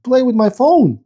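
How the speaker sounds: high-pitched exasperated complaint; annoyed. Real, not acted. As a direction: whine in frustration at a stubborn machine. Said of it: "why is this so annoying".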